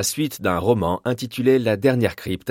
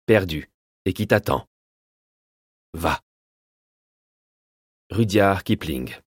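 solemn epigraph reading. Acted. Narrator reciting Kipling's lines as an opening epigraph, solemn. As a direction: intone it like a prophecy.

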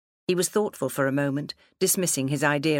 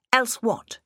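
high-pitched, sharp retort; defiant challenge; 9 semitones higher than usual. Acted. Rebellious daughter challenging her father's threat, defiant. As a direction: throw it back as a dare, pitched high.

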